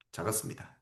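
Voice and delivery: 작았습니다 repeated as a soft unvoiced whisper; whispered echo. Shown as a demonstration. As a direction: breathe the word 작았습니다 again as an aside. Teacher echoes word softly.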